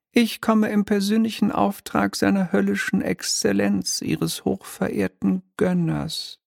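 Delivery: high, mannered little devil voice; a smug minor demon, lightly shrill and polite; oily demon clerk. Acted. Demon announces his master.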